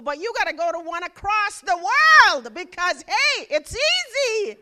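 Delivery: loud, high, mocking "it's easy"; sarcastic shout. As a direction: shout the irony at the dream. Sarcastic outburst about money.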